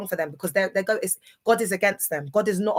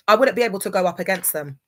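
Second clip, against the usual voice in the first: normal talking voice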